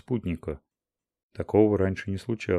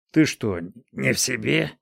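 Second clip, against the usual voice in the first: angry outburst; 5 semitones higher, 3 dB louder than usual. Acted. Old man snaps at woman.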